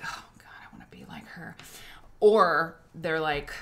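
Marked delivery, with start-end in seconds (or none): 0.0-1.6 low breathy whisper of envy; hushed, low, longing whisper; awed whisper. Retold or imitated. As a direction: whisper it like a starstruck fan.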